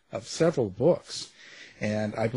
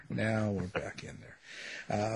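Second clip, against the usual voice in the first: much softer and slow, like fading away; quieter echo; 10 dB quieter, slower than usual. Shown as a demonstration. Host repeating the line to himself, soft and slow.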